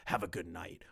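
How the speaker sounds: low-pitched imitation of a pompous warning; mock official threat. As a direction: quote him in a low, mocking voice. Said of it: "are you going to say".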